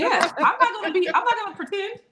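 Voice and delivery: laughing denial. Real, not acted. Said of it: "laughing teasing denial".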